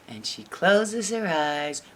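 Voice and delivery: usual speaking voice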